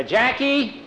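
put-on high call for the boy. Clyde calls Jackie over from the horses, high call.

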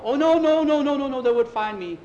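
loud, high frantic outburst; high wail easing slightly toward the end; panicked refusal. Acted. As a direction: refuse in acted terror. Frightened man refuses to flee.

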